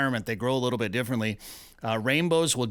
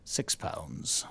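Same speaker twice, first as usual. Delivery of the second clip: whispered aside; 9 semitones lower, 9 dB quieter than usual. Acted. Joke answer whispered as aside.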